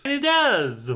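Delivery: can you believe it; incredulous. Real, not acted. Reviewer reacts to absurd plot.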